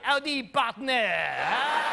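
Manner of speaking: slow Texan "Howdy, partner"; a French guy faking a cowboy greeting; mock cowboy drawl. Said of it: "French dude in a cowboy hat".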